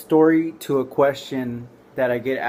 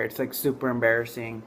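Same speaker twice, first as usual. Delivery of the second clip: ordinary delivery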